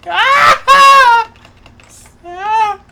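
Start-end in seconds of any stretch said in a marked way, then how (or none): 0.1-1.3 loud, high sustained scream; frustrated scream. Real, not acted. Player losing to rival's ricochet, high frustrated scream. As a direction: scream out as the game turns on you. Caught out in game.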